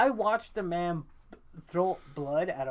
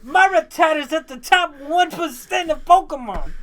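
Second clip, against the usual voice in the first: higher, louder mock New York accent with laugh; hammed-up Italian American accent; knockoff accent; 9 semitones higher, 9 dB louder than usual. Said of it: "I don't know what accent this is".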